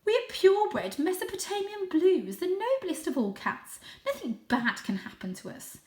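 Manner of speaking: high, haughty boasting; proud aunt cat. Acted. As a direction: puff up about noble blood. Aunt Junie boasts of breeding.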